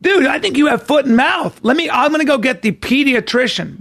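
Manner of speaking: high, excited "whoa dude"; doctor's startled exclamation; alarmed doctor. Retold or imitated. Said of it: "The guy goes".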